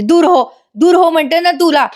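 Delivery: feigned madness. Acted. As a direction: fake a wild fit to fool him. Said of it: "नाटक करायला लागते लगेच वेडेपणाचं". (वेडेपणाचं नाटक करत ओरडणे)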